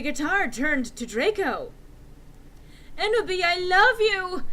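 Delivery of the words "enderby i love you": loud, high declaration; shouted love. Acted. Draco declaring love to Enderby, loud high shout. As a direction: belt out the confession. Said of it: "he shouted sadly".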